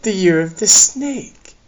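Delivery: slow, high snake voice; lightly hissed, high and slow; hissing snake. A narrator's reading. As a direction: draw it out as a snake would. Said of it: "she hissed".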